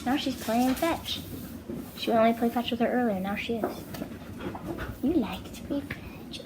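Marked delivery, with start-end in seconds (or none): 5.0-6.4 whispered, higher pet talk; soft whispered question to the dog. Real, not acted. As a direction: hush it to the dog.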